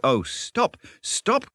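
high, overwhelmed squeal of delight; in ecstasies. A narrator's reading. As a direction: beg for mercy from sheer rapture. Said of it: "cried the mole in ecstasies".

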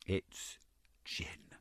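breathless whispered confession. A narrator's reading. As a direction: whisper the secret word. Confessing the gin.

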